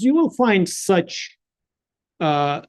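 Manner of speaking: usual speaking voice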